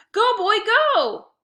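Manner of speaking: slow, high, loud cheering scream. A narrator's reading. Boy screaming at his dog racing after the frisbee, loud and slow. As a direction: scream him on as he races off. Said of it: "I screamed".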